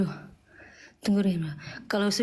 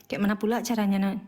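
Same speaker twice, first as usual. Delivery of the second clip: ordinary talk, nothing marked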